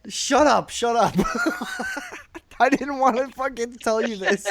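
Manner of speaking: high-pitched protest breaking into real laughter; embarrassed laughter. Real, not acted. Storyteller regretting the confession to friends, laughing in embarrassment. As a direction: squeal it out between laughs.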